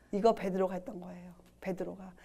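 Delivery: whispered aside to the listeners; confiding whisper. Acted. Preacher whispering to the congregation that this was Peter, hushed. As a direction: drop to a secret whisper.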